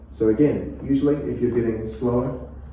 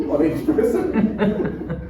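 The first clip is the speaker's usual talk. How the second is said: high laughing tag; 8 semitones higher, much slower than usual. Real, not acted. Coach laughing about eye contact.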